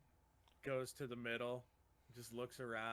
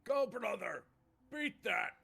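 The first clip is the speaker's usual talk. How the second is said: loud orc cheer, higher and louder; yelling in Orcish; 7 semitones higher, 8 dB louder than usual. Acted. Half orc Chunk cheering his brother in the arena, loud yell. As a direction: roar encouragement at your brother. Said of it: "gonna yell in Orcish".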